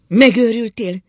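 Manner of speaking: high, loud, shocked shout. A narrator's reading. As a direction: cry out in disbelief. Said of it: "kiabált az asszony".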